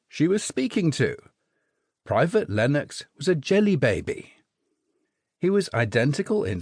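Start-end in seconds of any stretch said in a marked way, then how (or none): none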